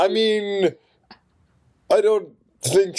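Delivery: high, stretched-out mock stalling; hammed-up, drawn-out hedging; awkward backpedalling. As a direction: imitate someone slowly covering a slip. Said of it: "That's exactly what he does".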